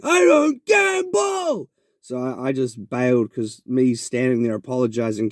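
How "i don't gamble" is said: high, drawn-out yell; imitated shouting. Beggar shouting back at the man who accused him of gambling, imitated shout. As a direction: stretch each word out in an outraged high-pitched shout.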